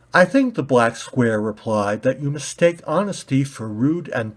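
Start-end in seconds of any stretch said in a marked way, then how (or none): 0.1-0.6 high, loud burst; suppressed rage. Acted. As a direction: let the anger break out.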